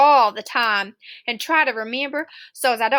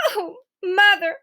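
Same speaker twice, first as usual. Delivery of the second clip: coughing out; 5 semitones higher than usual. Acted. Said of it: "Todd coughed out".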